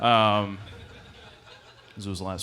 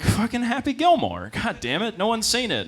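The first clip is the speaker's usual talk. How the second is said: put-on exasperated kid; 11 semitones higher, 3 dB louder than usual. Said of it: "I was like".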